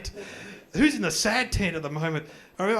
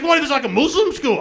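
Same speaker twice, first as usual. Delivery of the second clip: wife worried; 9 semitones higher, 8 dB louder than usual. Retold or imitated. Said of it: "She's like".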